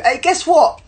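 high, loud repeated call; eagerly grab a stranger's attention; excited greeting. Retold or imitated. Bubbly guy repeating what he said to her, excited.

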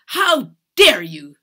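loud, slow, furious outburst; drawn-out, loud indignant demand; spat in anger. A narrator's reading. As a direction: spit it out with outrage. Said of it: "she spat".

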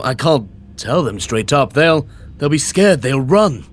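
ordinary delivery

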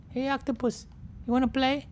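high, small put-on voice; friendly call. Whale asking an octopus to play, high-pitched call.

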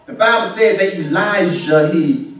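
ordinary talk, nothing marked

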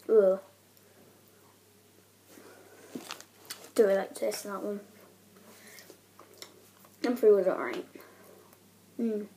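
talking through sticky chewing; chewing while talking. Real, not acted. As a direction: mouth full of sticky sweet. Seen 0: Grimacing, jaw and cheeks working throughout; food itself not visible.

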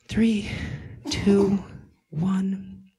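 whispered final count. Acted. Meditator whispering the last number of the countdown. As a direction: breathe the number out.

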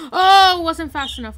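jolted by the blast; startled gasp. Real, not acted. Player caught by a creeper blast, startled loud cry. Creeper explodes beside her.

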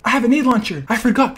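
ordinary talk, nothing marked

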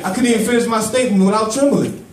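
rising preacher's cry; preaching with growing fervor. Acted. Preaching climax.

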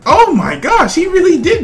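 high, loud, slow amazement; awed by the two-second chicken wing; amazed. Real, not acted. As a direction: drag out the awe. Reaction to fast eating.

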